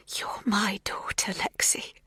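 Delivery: whisper. A narrator's reading. Magda whispers.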